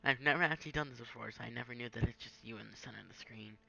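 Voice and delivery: ordinary delivery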